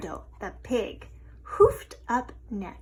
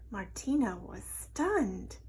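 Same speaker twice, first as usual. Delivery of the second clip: plain reading voice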